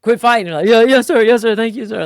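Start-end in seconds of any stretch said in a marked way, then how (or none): 0.0-0.5 loud, high imitated dad bellow; scolding dad. Dad ending his sons' fight, bellowed scolding. 0.7-2.0 high, loud, eager mock compliance; frantic obedience. Spanked boys answering their dad, frantic obedience.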